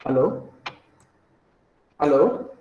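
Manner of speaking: ordinary talk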